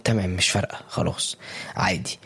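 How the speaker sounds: soft, low shrug of indifference; resigned, can't-be-bothered murmur; listless apathy. Acted. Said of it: "بنوصل لحالة من الفتور".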